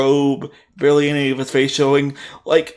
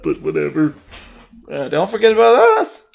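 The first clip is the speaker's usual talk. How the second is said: usual speaking voice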